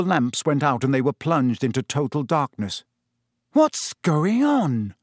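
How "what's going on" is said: slow, uneasy question, pitched up; puzzled fear as the lights die; nervous whisper-edge question; worried in the dark. Acted. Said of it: "said Ron's voice".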